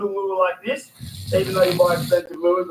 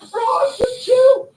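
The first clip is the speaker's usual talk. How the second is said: excited; 5 dB louder, much slower than usual. Real, not acted. Calming a wriggling fish.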